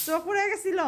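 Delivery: high laughing yell; laughing shout. Real, not acted. (হাসতে হাসতে চিৎকার)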